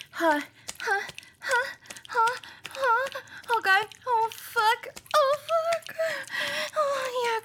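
panting that climbs into high cries; breathy pants turning into high-pitched oh fuck; rising cries. Acted.

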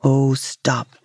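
lightly voiced low fisherman; a hint of a relenting fisherman. A narrator's reading. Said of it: "said the fisherman".

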